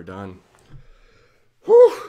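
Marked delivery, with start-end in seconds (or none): 1.6-2.0 very high, loud relieved whew; big exhale of relief. Real, not acted. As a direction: blow out the tension. Relief after the story.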